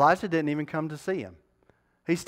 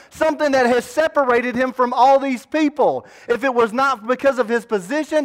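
preaching at full force; 10 semitones higher, 9 dB louder than usual. Acted. Preacher urges the sleepy congregation to feel the joy, fired up. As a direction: wake the congregation up with fire.

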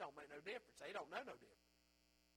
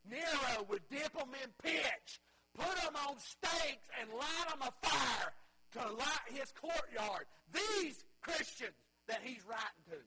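slow, loud, emphatic pulpit shout; preaching at full force; 5 semitones higher, 6 dB louder than usual. Acted. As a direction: thunder out the martyrs' fate. Preaching about Nero's persecution.

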